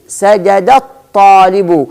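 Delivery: سجد الطالب slowly linked as "sajadat-taalibu"; slow demonstration, no "a" before الطالب after سجد; joined, hamza dropped. Shown as a demonstration. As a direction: glide from the dal of سجد straight into a doubled ta in الطالب. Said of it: "سأنتقل من الدال إلى الطاء مباشرة".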